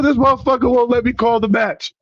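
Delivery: high, plaintive mock tattling; aggrieved high-pitched whine, played back for laughs; retold indignant complaint. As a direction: reenact a wronged complaint, pitch jumping up. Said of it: "I swear I said it just like that".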